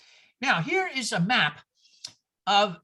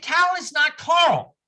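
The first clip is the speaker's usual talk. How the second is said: put-on slow, emphatic Boston accent; louder, drawn out, no R in either name; New England accent; 6 semitones higher, 6 dB louder, slower than usual. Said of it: "She said".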